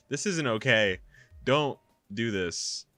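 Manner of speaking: loud, upset plea to stop; distressed protest. A narrator's reading. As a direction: protest loudly, dragging out the words. Protagonist protests as wrist is gripped.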